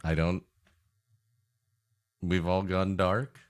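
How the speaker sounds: ordinary delivery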